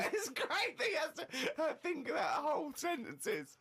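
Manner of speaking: high, helpless laughing speech. Real, not acted. Co-host tickled by how his friend thinks, laughing. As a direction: squeeze the words out through laughter.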